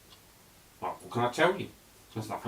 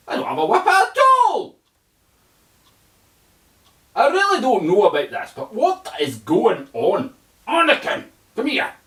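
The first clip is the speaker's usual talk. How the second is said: much higher, louder put-on voice; hammed-up Jedi master; Obi-Wan voice; 13 semitones higher, 12 dB louder than usual. Obi-Wan calling Anakin over, loud put-on voice.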